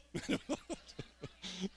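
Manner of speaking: high laugh dying off; trailing laugh. Real, not acted. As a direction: laugh it out after the call. Laughing after calling out.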